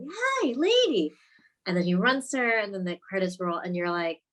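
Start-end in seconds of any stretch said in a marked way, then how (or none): 0.1-1.1 slow, high-pitched mock greeting; excited greeting. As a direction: draw out an excited hello in imitation. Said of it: "he sounds excited".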